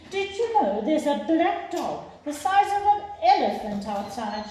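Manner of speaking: high, urgent alarmed call; mother alarmed, telling her husband; excited yell. A narrator's reading. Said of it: "she yelled".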